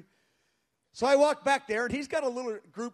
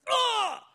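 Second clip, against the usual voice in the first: loud high cry of being struck down; imitated collapse cry; 7 dB louder than usual. Pastor imitates a man falling to the ground, crying out. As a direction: mimic a sudden overwhelmed yell.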